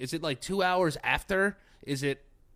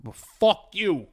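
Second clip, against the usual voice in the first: put-on Boston accent; 3 semitones higher, 9 dB louder than usual. Mock Boston tough-guy rant.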